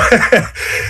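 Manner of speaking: short, high burst of laughter. Real, not acted. Guest breaking news to the host, short laugh. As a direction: let out a quick amused laugh.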